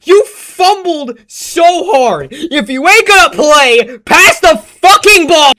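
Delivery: real fed-up venting; exasperated rant. Real, not acted. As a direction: lecture him like a fed-up friend. Ranting at the character.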